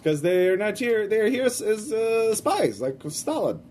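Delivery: put-on old grandma with a Russian accent. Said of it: "She says in a Russian accent".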